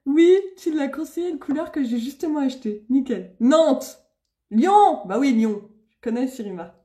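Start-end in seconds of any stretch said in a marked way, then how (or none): 3.4-5.0 excited call-out. Real, not acted. Streamer reading viewers' towns aloud, excited call-out. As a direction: announce the town gladly to everyone.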